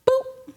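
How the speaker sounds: tiny high boop; quick high-pitched pop noise; popping sound. Retold or imitated. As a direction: imitate a little bubble popping. Imitates something popping.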